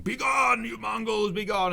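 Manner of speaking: high, loud mock proclamation shouting the Mongols away; hammed-up royal command. Ivan ordering the Mongols out, a high, loud mock shout. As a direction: ham it up like a king banishing invaders. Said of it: "image of him saying".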